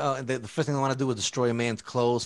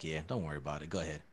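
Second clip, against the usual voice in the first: very soft, low imitation of a cop; low-key cop reassurance; 10 semitones lower, 14 dB quieter than usual. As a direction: quietly wave him on as the cop. Cop waves him on in story.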